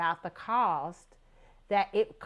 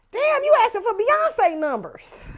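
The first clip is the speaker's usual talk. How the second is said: loud, high, slow disbelieving "Damn"; shocked outburst; 13 semitones higher, 10 dB louder than usual. Retold or imitated. To a friend naming her price, loud slow shocked disbelief. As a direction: stretch it out, floored by the price.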